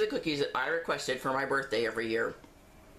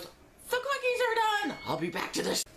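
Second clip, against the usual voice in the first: sing-song announcement. Real, not acted. Timer interrupts, sing-song announcement.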